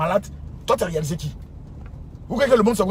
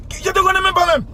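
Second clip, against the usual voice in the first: much higher, louder indignant cry; indignant outburst; 11 semitones higher, 4 dB louder than usual. Real, not acted. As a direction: fling it back at the stranger. Pasteur rejette un inconnu. (éclat indigné, beaucoup plus aigu)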